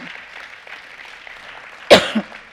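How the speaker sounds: loud real cough into the mic; a sudden coughing fit. Real, not acted. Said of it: "I'm up here coughing".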